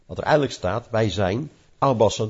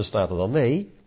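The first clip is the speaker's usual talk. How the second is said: ordinary talk, nothing marked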